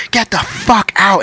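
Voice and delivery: high, slow mock yell; imitated angry order. Husband turning on the storyteller, imitated angry order. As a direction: draw out the retold order angrily. Enraged man turns on teller.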